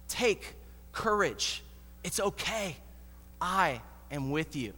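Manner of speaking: soft, unhurried reassurance; hushed, tender comfort. Acted. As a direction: settle a frightened friend gently. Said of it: "Jesus tries to comfort them".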